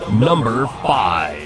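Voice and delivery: slow, dramatic announcer voice; drawn-out countdown announcer call; top ten countdown. Acted. Top ten list countdown card.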